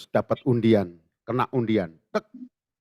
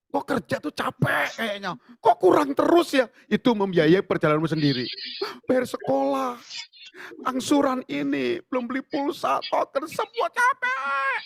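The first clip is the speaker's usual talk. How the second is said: mock whiny complaint; 10 semitones higher than usual. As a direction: sigh out the bills like a tired worker. Congregant complaining about money worries.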